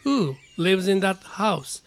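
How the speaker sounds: slow, "Who" stressed; teacher's slow example sentence; slow model reading. Shown as a demonstration. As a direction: read it out slowly and clearly. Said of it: "で、下。".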